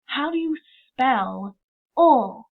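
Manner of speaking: "How do you spell O?" drawn out slowly; slow teacher question. Shown as a demonstration. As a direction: ask slowly so the children follow. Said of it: "I say".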